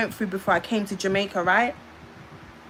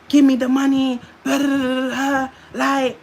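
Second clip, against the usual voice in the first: loud, higher mock shout demanding the money; half-imitating the man yelling for his cash, louder; angry demand; 4 semitones higher, 4 dB louder than usual. Imitating the boyfriend shouting at her over the money, loud and higher.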